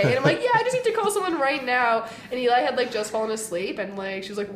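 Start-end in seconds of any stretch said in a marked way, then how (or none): 0.4-2.1 high, breathless rush of good news; blurting it out, buzzing; excited. Retold or imitated. To the Uber driver, bursting with excitement. Excited retelling of acceptance night.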